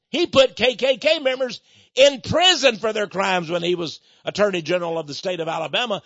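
normal talking voice

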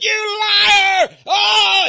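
imitating the heckler's shriek in the hallway; retold scream. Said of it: "He would just scream and hollered".

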